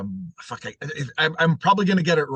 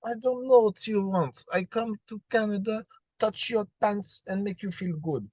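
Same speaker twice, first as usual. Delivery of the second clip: higher, hammed-up Russian accent; mock Russian accent; 7 semitones higher than usual. Friend acts out the Russian woman in a mock Russian accent. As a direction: put on a flirty Russian accent, slowing on touch your pants. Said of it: "the Russian one is just like".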